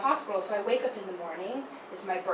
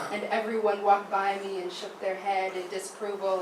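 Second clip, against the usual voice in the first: ordinary talk, nothing marked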